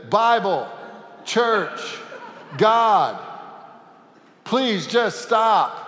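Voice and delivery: loud, high pleading shouts, slowing at the end; desperate shouting. Acted. Man rushing at a cliff, shouting high and loud. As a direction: throw every holy word at the runaway donkey. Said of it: "his shouting".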